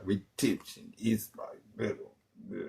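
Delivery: soft, hushed, eerie, low; a hammed-up hushed oracle line; mock mystic whisper. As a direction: breathe it like a seer.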